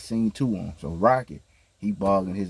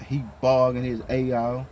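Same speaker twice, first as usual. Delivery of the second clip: normal talking voice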